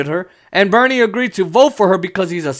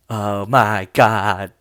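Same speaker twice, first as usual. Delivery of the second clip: much lower, very slow fretful sigh; Susan Collins impression; 9 semitones lower, much slower than usual. Mocking Susan Collins for viewers, low slow fretting. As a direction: drag it out low and worried. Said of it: "that's how she talks".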